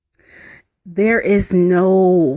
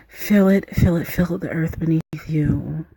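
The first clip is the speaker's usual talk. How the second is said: ordinary delivery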